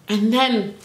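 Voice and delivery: ordinary talk, nothing marked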